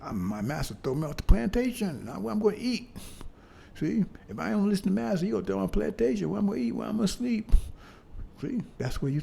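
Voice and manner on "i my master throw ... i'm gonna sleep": high, pleading voice of a worried slave; mock fearful slave. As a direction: play the scared slave begging master.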